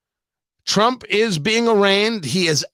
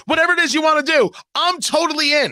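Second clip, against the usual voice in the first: eager bettor; 9 semitones higher, faster than usual. Acted. Eager to join a bet.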